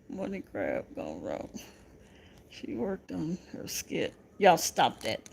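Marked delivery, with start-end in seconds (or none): none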